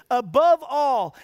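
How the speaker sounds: "Above all" slow and loud; slow, weighty "Above all"; stressed above all. Shown as a demonstration. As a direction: lean hard on "Above all". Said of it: "reads verse emphatically".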